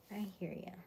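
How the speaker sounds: usual speaking voice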